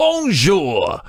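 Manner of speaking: high, hammed-up British "bonjour"; French greeting in a very British accent; thick British-French accent. Podcaster mimics eccentric islander greeting visitors, thick British-French accent. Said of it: "very thick British accent inside of his French accent".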